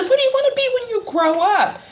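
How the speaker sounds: put-on high, quick, amused question to a kid; imitated grown-up quizzing a child; talking to a child. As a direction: pitch it way up, as to a little boy. Asking a child, retold.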